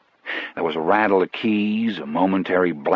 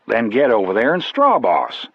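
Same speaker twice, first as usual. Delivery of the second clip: raised voice; 4 semitones higher, 4 dB louder than usual. A narrator's reading. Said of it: "raising my voice".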